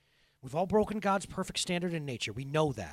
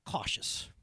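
whispered; 4 semitones lower, 5 dB quieter than usual. Real, not acted. Speaker whispers a single word.

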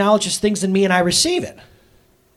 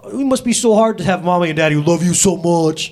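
teasing dad; 4 dB louder than usual. Retold or imitated. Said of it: "I go".

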